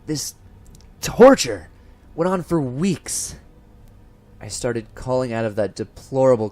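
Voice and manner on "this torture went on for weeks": anguished outburst. Acted. Narrator recalls his torment.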